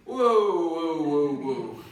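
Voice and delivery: ordinary talk, nothing marked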